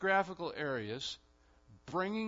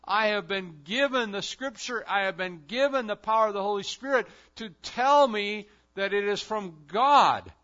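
raised, louder preaching voice as Luther; impassioned Luther quote; 6 semitones higher, 12 dB louder than usual. Acted. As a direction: proclaim it like Luther defying the church.

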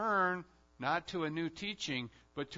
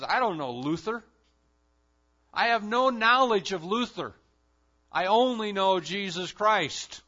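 louder, rising declaration; defiant Zwingli quote; 6 semitones higher, 10 dB louder than usual. Acted. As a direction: thunder it out as Zwingli. Zwingli rejects Luther's pamphlets.

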